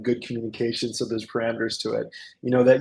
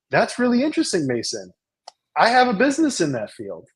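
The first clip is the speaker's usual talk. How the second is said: high, swooping mock-intrigued voice; feigned keen interest; 7 semitones higher than usual. Leader fishing to poach young Mason, feigned keen interest. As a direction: play up exaggerated interest, pitch leaping up then gliding down. Acts out sly pitch to young man.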